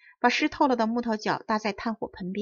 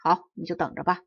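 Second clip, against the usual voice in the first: put-on low, sly old man voice; a grumpy old man with a trick in mind; mild hint of a scheming old man; 4 semitones lower than usual. A narrator's reading.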